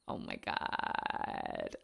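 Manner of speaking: breathy whisper, slowed right down; a soft awed whisper; whispered oh my God. Real, not acted. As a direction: let it out on a hushed breath of delight. Unvoiced gasped exclamation.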